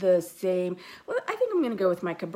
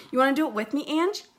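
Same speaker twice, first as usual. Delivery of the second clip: raised voice to someone nearby; calling out; 8 semitones higher, 5 dB louder, much faster than usual. Real, not acted. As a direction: call across the room. Calling out to Ange.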